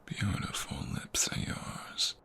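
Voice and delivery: slow, soft whisper between kisses; intimate ASMR whisper. Acted. Boyfriend kissing his partner's cheeks and lips, whispering softly. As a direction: keep it hushed and unhurried, close to her ear.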